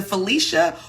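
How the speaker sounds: "Felicia" with a long EE in the middle. Shown as a demonstration. Checking a viewer's name, long-EE "Felicia". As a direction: say "Felicia" as fuh-LEE-shuh. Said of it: "I know there's a difference".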